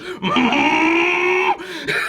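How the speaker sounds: imitated volcanic rumble; long strained noise of pent-up fury; mock eruption building up; volcano about to erupt. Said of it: "He's like Mount Vesuvius, just".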